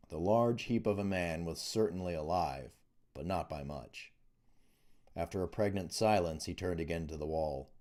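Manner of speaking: ordinary delivery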